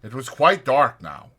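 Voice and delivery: ordinary delivery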